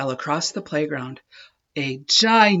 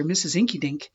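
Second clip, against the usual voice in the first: ordinary delivery, nothing marked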